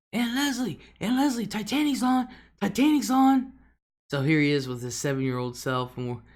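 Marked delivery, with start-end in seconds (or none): 0.1-3.5 put-on high, eager kid voice calling aunt; excited little boy. Nephew calling aunt to the TV, excited child voice. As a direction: imitate a seven-year-old calling out. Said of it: "he'd be like".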